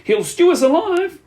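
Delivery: high wail in a changed little voice; terrified child's wail. A narrator's reading. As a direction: wail it out like a doomed little boy. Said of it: "wailed the second one".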